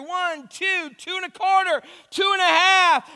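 frantic parent dragging out the count; stalling count. Acted. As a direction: stretch the count out desperately.

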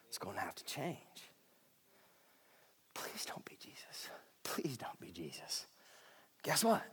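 hushed dramatic whisper; whispered revelation of Jesus. Acted. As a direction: drop to a breathy whisper.

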